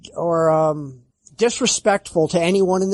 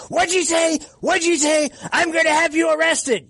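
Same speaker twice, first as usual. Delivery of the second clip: mock outraged clerk, pitched higher; imitating angry clerk; 8 semitones higher than usual. Clerk threatening the caller with arrest, mock outrage. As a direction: play the clerk snapping back, offended. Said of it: "he gets really pissed off".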